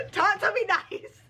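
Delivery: high squeal settling into laughing joy; overjoyed. Real, not acted. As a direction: gush with real delight. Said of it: "I am so happy".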